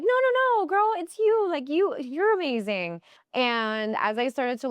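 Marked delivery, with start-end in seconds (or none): 0.0-3.0 typical woman deflecting. Retold or imitated. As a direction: squeal it back at her in a high voice. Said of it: "Women are like".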